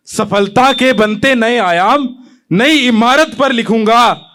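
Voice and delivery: measured, declaimed patriotic verse; poem recitation. Acted. Young poet opens his poem to the audience, declaimed. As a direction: recite it to a hall, line by line.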